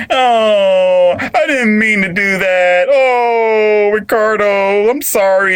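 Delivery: high, loud mock wailing apology; hammed-up sobbing plea; fake crying. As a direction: wail like a wife crying to get her way. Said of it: "crying to get her way".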